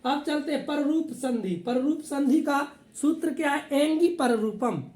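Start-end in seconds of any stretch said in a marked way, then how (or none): none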